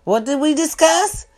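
normal talking voice

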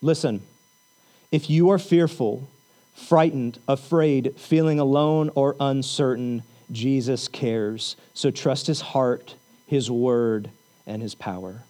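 low, soft, comforting preacher voice; gentle pastoral reassurance. Real, not acted. Pastor comforts the fearful congregation.

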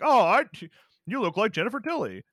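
high squealing fan gushing, played for laughs; exaggerated squeaky fan voice; mock starstruck fan. Mocking a fan spotting Jennifer Tilly.

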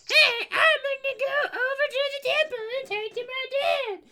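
very high squeaky puppet voice; pinched high cartoon puppet; goofy muffled sock puppet. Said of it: "And it'd be like".

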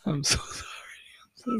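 giggly whispered sorry. Real, not acted. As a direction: whisper the apology through giggles. Laughing apology after a fart.

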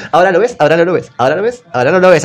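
quick singsong trick patter; fast magician patter. Acted. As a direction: rattle it off like a street magician. Jesús como mago. (patter de mago, rápido)

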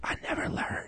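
unvoiced whispered confession. Telling the cashier his secret, in a whisper. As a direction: breathe it like a secret.

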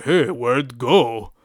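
slow, confused cartoon giant; put-on dim giant voice, slow and puzzled; Blob. Giant looking around after Nightcrawler teleports, slow confused voice. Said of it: "looking around all confused".

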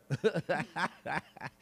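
acted chuckle, a run of ha-ha-ha; imitated laughing. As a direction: laugh as if teasing someone. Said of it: "starts laughing at me".

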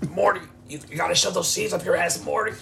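mock Rick lecturing Morty; lightly voiced Rick. Continues Rick impression, laughing.

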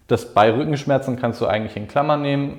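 ordinary delivery

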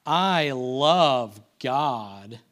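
slow, low, drawn-out mock devotion; sarcastic tone. Shown as a demonstration. Preacher showing what worship should not sound like, sarcastic. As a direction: stretch every word with insincere weight. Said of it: "in this tone of voice".

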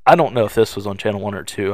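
ordinary talk

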